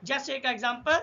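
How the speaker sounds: ordinary delivery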